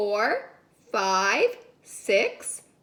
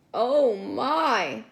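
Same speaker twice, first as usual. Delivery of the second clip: usual speaking voice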